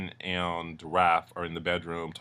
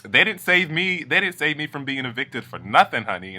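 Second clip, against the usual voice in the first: sassy boast; 6 semitones higher, 4 dB louder than usual. Retold or imitated. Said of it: "she stands up and she's like".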